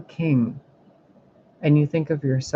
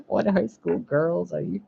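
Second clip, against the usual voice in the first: higher, laughing taunt; laughing mockery; 4 semitones higher, 5 dB quieter than usual. Real, not acted. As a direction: laugh at them while teasing. Mocking male tarot readers.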